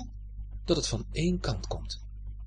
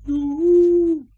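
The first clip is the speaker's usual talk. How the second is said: high, drawn-out fading echo call; thinner, held far-away yoo-hoo; soft distant echo; 9 dB louder than usual. A narrator's reading. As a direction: echo the holler back from afar. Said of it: "klinkt het heel zachtjes terug".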